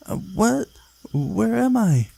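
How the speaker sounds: plain reading voice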